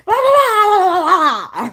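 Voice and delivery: very high, loud taunting la-las. Acted. Refusing to hear the lover, loud high la-la-la. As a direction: belt out la-las to drown someone out. Drowning out the suitor.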